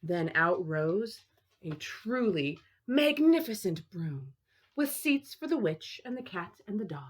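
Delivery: plain reading voice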